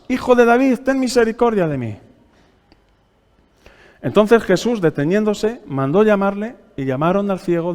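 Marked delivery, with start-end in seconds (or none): 0.1-2.0 a higher, louder, more urgent plea; crying out louder. Acted. Blind Bartimaeus cries louder over the scolding crowd, urgent plea. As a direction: cry out over the crowd that hushes him. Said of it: "él clamaba mucho más".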